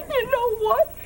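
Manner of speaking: high-pitched, helpless laughter through the words; laughing. Acted. Woman ending her tale of the outing, laughing. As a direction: laugh your way through the punchline.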